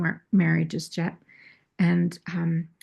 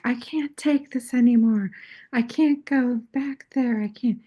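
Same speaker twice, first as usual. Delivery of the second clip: higher, pleading quoted voice; a hint of a frantic partner; mock distressed wife; 6 semitones higher than usual. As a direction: play her overwhelmed and at the end of her rope. Retold partner's distressed outburst.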